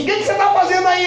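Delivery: voz bem mais aguda e rápida; pergunta aguda. Retold or imitated. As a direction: crente curioso no ônibus. Crente pergunta no ônibus. (crente pergunta com voz aguda)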